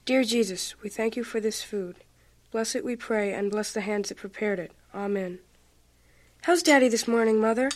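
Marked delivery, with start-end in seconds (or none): 0.0-5.4 quiet, reverent grace before meals; prayer. Acted. Child says grace.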